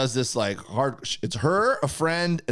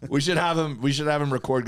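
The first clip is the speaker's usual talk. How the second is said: ordinary delivery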